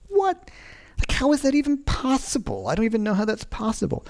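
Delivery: high, stunned "what, how is that even possible"; mock gasping amazement at impossible chops; amazed disbelief. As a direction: play the awestruck Instagram fan. Guys on Instagram reacting, amazed.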